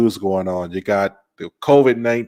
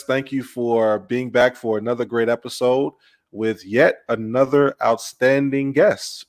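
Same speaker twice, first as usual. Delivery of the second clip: normal talking voice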